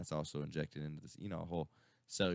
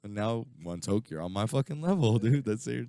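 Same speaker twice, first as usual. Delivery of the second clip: gloating laugh; 7 semitones higher, 8 dB louder than usual. Retold or imitated. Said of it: "I was like".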